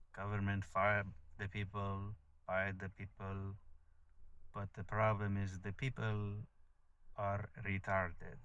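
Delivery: slow, lightly put-on guru accent that slips toward Spanish; a hint of a mock Spanish-tinged guru accent; mock Osho accent. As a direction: drawl it like a guru's lecture, slowly. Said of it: "turned Spanish at the end".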